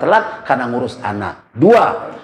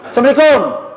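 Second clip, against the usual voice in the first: high, loud greeting at the door; husband calling greeting; 9 semitones higher, 3 dB louder than usual. Acted. Husband arriving home greeting his wife, loud call. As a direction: call out from the doorway.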